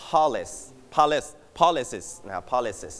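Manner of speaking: usual speaking voice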